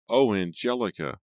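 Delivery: a touch of anguished alarm; frightened cry. A narrator's reading. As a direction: cry out in fright over wife. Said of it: "Simon's frightened cries aroused Angelica".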